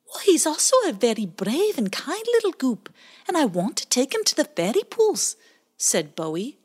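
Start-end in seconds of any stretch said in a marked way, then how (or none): 0.1-5.4 high, earnest little otter voice; defending otter. Acted. Bowie defends Pie Jam to the blackbird, high earnest. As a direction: stand up for a friend.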